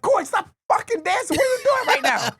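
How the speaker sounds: high, exasperated mock yelling; retold angry yell. Yelling at Corey to stop dancing, high exasperated yell. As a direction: snap at her dancing.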